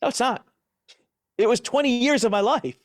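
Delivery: high, loud mock protest; retold firm retort; forceful rebuttal. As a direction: push back hard against the comment. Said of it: "I'm like".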